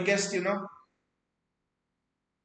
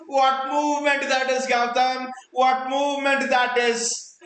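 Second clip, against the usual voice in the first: excited; 6 semitones higher, 3 dB louder than usual. Real, not acted. Raving about the goal replay.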